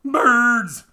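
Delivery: high, loud joyful shout; happy shout. A narrator's reading. A happy sailor spotting birds, shouting with joy. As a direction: yell it out with delight. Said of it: "shouted a happy sailor".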